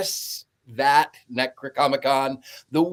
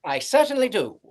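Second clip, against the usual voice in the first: put-on high, whispery old movie affectation; put-on forties voice; 7 semitones higher than usual. Co-host asked for the synopsis, hammed-up high forties reply. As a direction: overdo a breathy film-star reply. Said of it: "I'm not going to carry that through".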